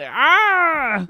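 sudden high, loud pained yelp. Retold or imitated. Man hit by a loud noise yelping in pain. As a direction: shriek like a blast hit your ear. Retold yell into the ear.

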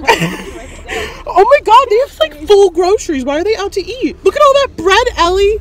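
high, loud amazed gush; excited. Real, not acted. Amazed at strangers' groceries.

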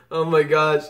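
ordinary talk, nothing marked

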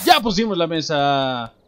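loud happy cheer over the table; triumphant shout. Real, not acted. Player celebrating the set table, loud triumphant cheer. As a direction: call out the win proudly.

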